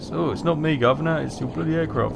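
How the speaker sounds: a hint of a whingeing pilot passing the blame; whiny pilot. Mechanic mimicking a pilot who blames the aircraft, lightly whiny. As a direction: lightly mimic a pilot moaning it's not his fault. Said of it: "then they moan and say".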